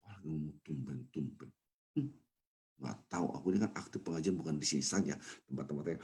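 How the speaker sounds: hurt mutter, barely audible; sulky grumble. Retold or imitated. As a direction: grumble under your breath, offended. Offended newcomer grumbles to himself.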